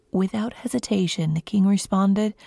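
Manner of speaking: plain reading voice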